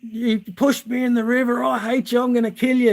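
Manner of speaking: high-pitched angry yell, no real volume; mock screaming. Mate pushed into the river threatens his friend, mock screaming. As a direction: imitate a furious mate in a strained high voice. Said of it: "he starts screaming and yelling at me".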